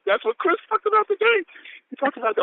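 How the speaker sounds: high-pitched, thrilled aside; squealing enthusiasm; excited. Real, not acted. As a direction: burst out high with recognition. Excited reaction to own quote.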